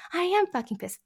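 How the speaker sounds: high, relieved confirmation; relieved agreement. Acted. Client confirming mediator understood the anger, relieved. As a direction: agree gratefully, way up in pitch. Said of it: "I'm so glad someone finally understands".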